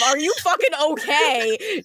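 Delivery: booming goddess voice; game goddess voice. Acted. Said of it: "in my game goddess voice of".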